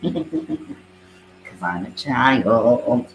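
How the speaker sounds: a hammed-up slow Southern drawl, Foghorn Leghorn style; mock Southern accent. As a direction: drag it out in a joking Southern twang. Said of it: "I sound like Foghorn Leghorn".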